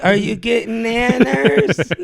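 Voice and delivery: put-on slow, high, sing-song baby talk; patronizing, like to a toddler; talking down to a child. Talking to old person like kid.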